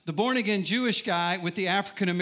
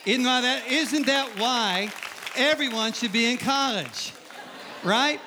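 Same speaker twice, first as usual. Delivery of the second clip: rousing question; 5 semitones higher, slower than usual. Real, not acted. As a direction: pull the audience in, drawn out, then snap up on right. Preacher rouses crowd with question.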